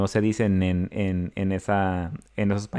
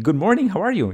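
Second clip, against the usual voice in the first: teacher greeting again, higher; teacher repeating; 9 semitones higher than usual. Retold or imitated. Teacher repeating the greeting to the confused boy, higher. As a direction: repeat the greeting, leaning on morning.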